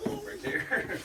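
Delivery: very high squeaky right there; playful goofy voice; silly squeak. Pretend. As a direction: pop it up into a goofy falsetto. Silly repeat while pointing.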